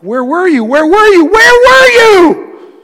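put-on a hurt child's wail rising to a shout; anguished cry building. Sobbing man crying out to God in an auditorium, a rising anguished wail. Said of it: "I'm like".

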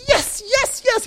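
high-pitched imitation of a jubilant prophet; so excited. Prophet after God lit the sacrifice, excited. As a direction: cheer it as the thrilled prophet. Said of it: "He was so excited".

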